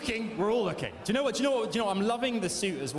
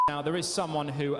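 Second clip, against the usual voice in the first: ordinary talk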